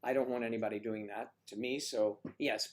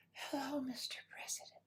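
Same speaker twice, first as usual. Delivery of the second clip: soft, much higher whisper of a seductive greeting; breathy flirty whisper; 13 semitones higher, 9 dB quieter than usual. Retold or imitated. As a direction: breathe it like a sultry star greeting a president.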